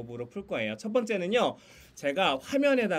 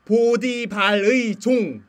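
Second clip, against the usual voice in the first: very slow, louder, every syllable of 보디발의 종 stretched out; exaggerated mouthing; 4 semitones higher, 9 dB louder, much slower than usual. Shown as a demonstration. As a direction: spell it out for lip readers. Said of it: "소리 내면서 말하시면 더 편해요". (입모양 보이게 아주 천천히 크게)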